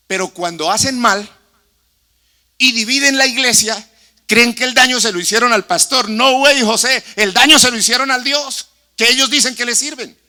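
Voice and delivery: loud, high, full-force sermon; fiery preaching. Acted. Pastor rebuking church dividers, loud fiery preaching. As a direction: thunder it from the pulpit.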